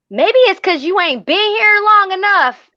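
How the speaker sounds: high, loud Southern country twang. Retold or imitated. Answering the coworker who questioned the accent, loud country twang. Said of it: "and the twang starts coming out".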